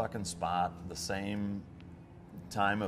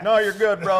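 a loud, high, breezy brush-off; mock dismissive instructor, higher and louder; hamming up a careless "you're good"; 12 semitones higher, 12 dB louder than usual.

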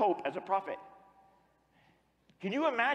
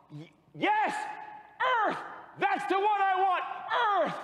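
elated exclamation; 13 semitones higher than usual. Acted. God spotting Earth among all worlds, elated. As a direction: exclaim it high, as if just found.

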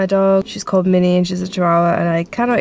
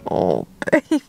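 tender cooing. Real, not acted. Owner comforts her crying puppy, tender coo. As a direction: soothe him gently.

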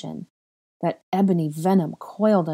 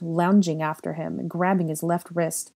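usual speaking voice

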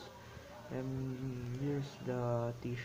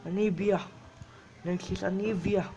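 1 excited announcement; 5 semitones higher, 7 dB louder than usual. Real, not acted. Announcing champion name.

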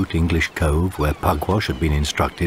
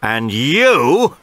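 higher, slow, commanding arrest; triumphant officer; 6 semitones higher, 4 dB louder than usual. Acted. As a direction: gloat as you make the arrest. Officer points at captain.

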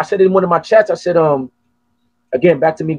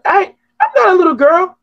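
high mock whiny girl voice, much higher; mock girlish protest; 3 dB louder than usual. Imitating a woman who insists she's not a little girl, high and mock-whiny. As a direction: squeak it like a defiant little girl. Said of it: "She was like".

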